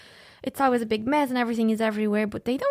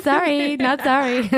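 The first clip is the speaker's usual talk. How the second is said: laughing mock apology; 3 semitones higher, 3 dB louder, slower than usual. Real, not acted. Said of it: "playful jab after ego remark".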